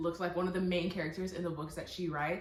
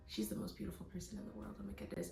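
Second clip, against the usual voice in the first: very soft, quick acted swoon; imitated awestruck undertone; hushed adoring quote; 13 dB quieter, faster than usual. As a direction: murmur it fast, like a smitten boy. Voicing the smitten boy's reaction.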